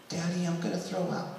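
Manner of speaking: put-on soft, sweet child's voice; quiet sweet little girl. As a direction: whisper it sweetly like a sick kid. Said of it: "very quietly and very sweetly".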